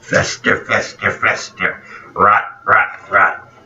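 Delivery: slow, taunting chant of fester; mock her mocking tone; mocking Meg Ryan. Host imitating Meg Ryan mocking him, slow taunting. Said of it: "That was Meg Ryan making fun".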